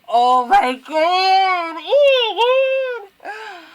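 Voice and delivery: high, loud, drawn-out cry; dismayed wail. Real, not acted. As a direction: wail it slowly, climbing into a squeal. Wailing at character's harsh rejection.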